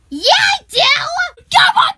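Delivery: high, loud squeals of glee; thrilled cheering. Acted. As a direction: shout eagerly about a treat, stretched out. Thrilled by offer of candy.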